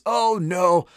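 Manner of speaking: playful, drawn-out "oh no"; mock dismay. God reacting to man's sin, in mock dismay. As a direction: pretend to be caught out, lightly.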